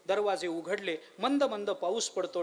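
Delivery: ordinary delivery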